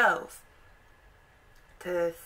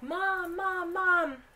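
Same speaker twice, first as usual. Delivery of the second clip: much higher, eager calling out to parents; excited kids; 5 semitones higher than usual. Retold or imitated. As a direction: rush in calling for mom, high. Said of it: "they're like".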